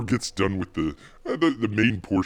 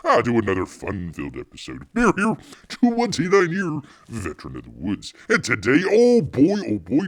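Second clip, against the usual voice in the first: bear persona intro; 7 semitones higher, 4 dB louder than usual. Pretend.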